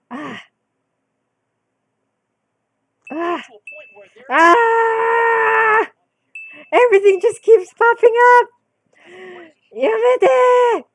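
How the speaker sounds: real high yells as popups flood the screen; panicked shouting. Real, not acted. Streamer yelling at her phone flooding with popups, panicked shout. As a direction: shriek to make it stop.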